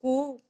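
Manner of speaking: "कु" drawn out as koo; unhurried, stretched oo in "कु"; long oo sound. Shown as a demonstration. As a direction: hold the vowel of "कु" long and easy. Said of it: "आराम से बोलेगी ये".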